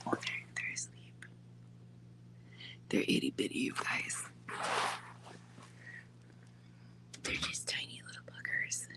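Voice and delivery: soft whisper, careful not to wake them; hushed voice beside sleeping babies; whispering over a crib. Real, not acted.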